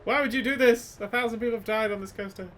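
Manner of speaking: loud, high hammed-up warning cry; mock doom prophet shout. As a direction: play a wild-eyed park doomsayer yelling at riders. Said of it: "just shouting, like".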